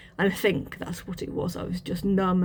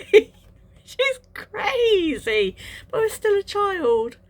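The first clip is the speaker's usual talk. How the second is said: crying through the words, much higher; 12 semitones higher, 5 dB louder, slower than usual. Real, not acted. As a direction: voice breaking high into tears, slowed right down. Voice breaks recalling childhood.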